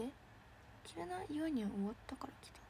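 whispered, breathy re-read of the comment. Shown as a demonstration. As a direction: hush it all the way through. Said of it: "re-reading it whispered". (ささやき声で読み直す)

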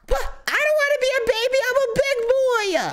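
put-on high pouty child; whiny kid voice. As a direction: whine like a little boy. Protesting baby day.

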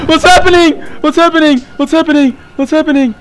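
high, frantic repeated cries, easing off; frightened yelling, losing force; panicked. Real, not acted. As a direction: shout it in real panic, gradually subsiding. Panic after jump scare.

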